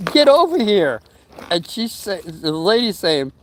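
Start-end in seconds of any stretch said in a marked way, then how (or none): 0.1-1.0 higher, louder call for help; retold shout. Telling the 911 operator to come, raised urgent shout. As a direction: bark it at the dispatcher. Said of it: "I just said".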